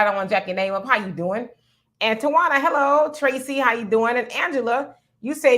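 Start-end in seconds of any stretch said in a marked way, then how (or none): none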